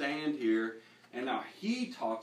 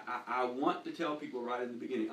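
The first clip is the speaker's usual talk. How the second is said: ordinary talk, nothing marked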